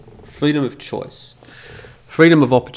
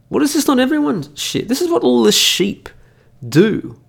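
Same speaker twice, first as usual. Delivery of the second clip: indignant rant; 12 semitones higher, 4 dB louder than usual. Real, not acted. Ranting against excuses.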